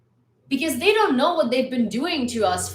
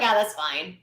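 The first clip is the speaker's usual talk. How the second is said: mock indifference; 6 semitones lower than usual. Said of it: "you were like".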